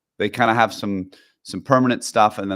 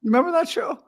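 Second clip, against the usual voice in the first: very high, squeaked question; squeaky mock voice; faster than usual. Recalling Archie Bunker, a silly squeaky voice. Excited TV nostalgia.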